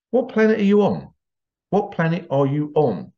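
incredulous mock challenge, pitched high; exasperated retort. Commenters answering the upset people, mock-exasperated. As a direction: imitate indignant commenters, voice jumping up.

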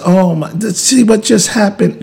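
high, loud sudden alarm; mock startled exclamation. Person washing dishes drops a plate, startled high cry. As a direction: yelp as the dish breaks. Dish breaks mid-conversation.

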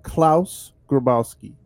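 slow, careful German-style name; German pronunciation. Real, not acted. Podcast host names the suspect, German pronunciation. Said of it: "That sounds pretty German".

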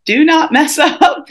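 high, pleading self-warning; mock inner voice. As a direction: tell yourself not to mess up.

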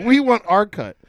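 high, loud mock tax man; pushy demand. Taxman shaking down an earner, loud demand. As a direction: demand it loudly, playing the taxman.

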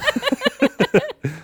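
squeaky granny laugh; high old lady cackle. Retold or imitated. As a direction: cackle like a little old woman. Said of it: "Can you do an old lady voice".

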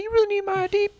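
put-on high voice of an older woman. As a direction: pitch it way up as the older lady. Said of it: "I can't do the voice".

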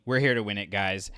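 normal talking voice

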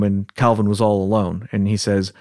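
ordinary talk, nothing marked

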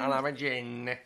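lightly voiced EastEnders barmaid; a hint of an old London barmaid; Dot Cotton impression. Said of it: "That was my impression of her".

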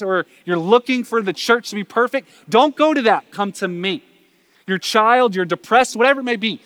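fervent pulpit call; preaching at full force. Acted. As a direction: plead with them loud and urgent. Impassioned appeal to the congregation.

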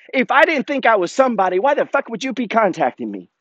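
high-pitched, heated comeback; exasperated comeback; indignant retort. Retold or imitated. Resumes retort to the kid.

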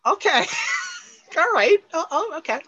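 high, laughing acknowledgement; amused surprise. Real, not acted. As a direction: crack up in a high-pitched voice. Amused surprise at the pinball answer.

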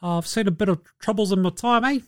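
a hint of laid-back New Zealand big man; mock New Zealand accent. Steven Adams warning Ja about his crew, mild New Zealand accent. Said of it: "Steven Adams... you go".

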